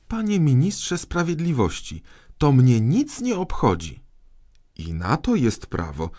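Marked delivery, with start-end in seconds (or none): 0.1-4.0 high-pitched, emphatic rebuke; exasperated dismissal. A narrator's reading. Boy king fed up with the minister's paragraphs, exasperated. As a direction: lose patience and push the key words hard.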